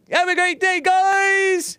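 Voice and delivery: very high, loud squeaky sign-off; squeaky cartoon voice. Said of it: "Hake voice".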